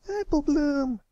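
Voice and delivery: high, loud call. A narrator's reading. Filly calling after her running friend, loud. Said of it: "Sweetabelle called out".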